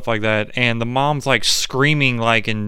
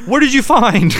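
high, louder hammed-up voice; cheery mock question; 12 semitones higher, 6 dB louder than usual. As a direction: chirp it like nothing's wrong. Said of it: "and he's like".

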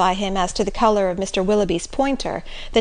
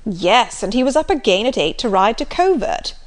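hearty Sir John. Acted. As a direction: boom it out like a jolly squire.

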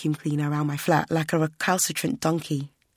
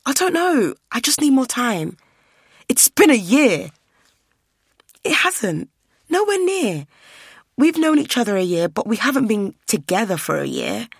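higher and louder, voice climbing in distress; heated, upset; 6 semitones higher, 6 dB louder than usual. Acted. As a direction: argue back, panicking and cornered. Verity defending herself, heated quarrel.

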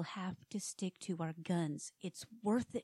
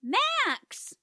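loud high shout; 13 dB louder than usual. Acted. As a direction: cut him off with a yell. Wife interrupts presenter.

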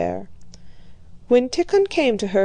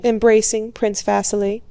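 ordinary delivery, nothing marked

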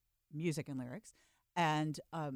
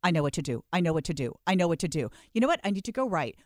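eager repeated exclaim; 8 dB louder, faster than usual. Retold or imitated. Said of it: "Jody goes".

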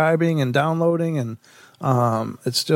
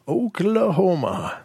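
Oklahoma stretched slowly, higher, falling at the end; drawn-out repeat; 6 semitones higher, slower than usual. Pretend. Host announcing the next story's state, Oklahoma drawn out. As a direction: draw out each vowel of Oklahoma.

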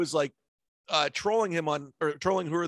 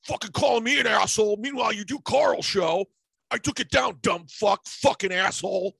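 higher, louder mock rant; rattling off a furious message, raised and heated; angry rant; 6 semitones higher, 4 dB louder than usual. Comedian reads a DM from an angry comic, raised and heated.

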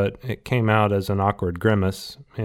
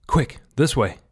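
quick, urgent, a bit higher; urgent call; 5 semitones higher than usual. Acted. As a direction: hurry them along. Urging escape.

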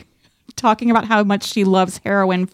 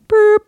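high, louder bleep sound, boop; mock bleep; 13 semitones higher, 6 dB louder than usual. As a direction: squeak out a censor bleep as boop. Imitating a censor bleep.

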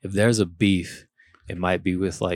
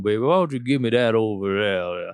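Foghorn Leghorn; 2 semitones higher than usual. Retold or imitated. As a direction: lay on a mock old Southern gentleman. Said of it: "foghorn leghorn".

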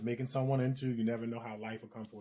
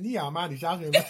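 laughing Chinese; 3 semitones higher, 5 dB louder than usual. Retold or imitated. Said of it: "I said".

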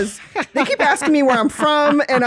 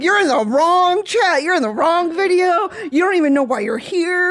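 mock followers; 7 semitones higher than usual. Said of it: "they're like".

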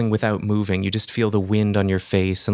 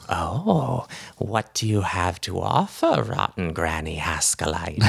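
put-on sly old hag; 3 semitones lower than usual. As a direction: hiss the bargain like a crafty witch. Hag bargains with the visitor.

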